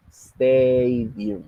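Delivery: STAAA-dium, first syllable held long; exaggerated first-syllable stress. Shown as a demonstration. Teacher repeating the example, Stadium with a drawn-out first syllable. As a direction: stretch out the opening syllable of Stadium. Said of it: "está al principio".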